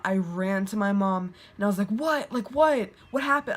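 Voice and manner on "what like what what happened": higher-pitched, mildly panicked questioning; retold scared alarm. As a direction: re-enact being startled and scared. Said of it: "I was so scared".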